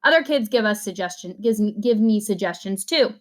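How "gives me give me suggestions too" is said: plain reading voice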